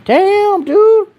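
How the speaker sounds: real surprise, voice jumping up; surprised. Real, not acted. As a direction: blurt it out in shock. Surprised reaction to viewer.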